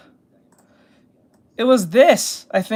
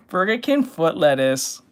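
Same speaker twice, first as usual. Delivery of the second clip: usual speaking voice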